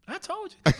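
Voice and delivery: high, smug mock gloat.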